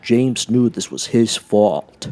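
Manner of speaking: ordinary delivery, nothing marked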